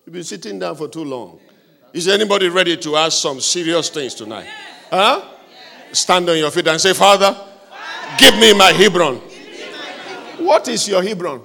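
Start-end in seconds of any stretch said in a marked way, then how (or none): 1.9-11.4 rising, fervent preacher's cry building louder and higher; preaching at full force. Acted. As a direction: whip up the whole congregation, growing to a roar. Preacher drives congregation to pray.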